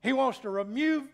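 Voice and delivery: usual speaking voice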